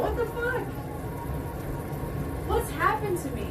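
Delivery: real disbelief. Real, not acted. Eyes burning from onion.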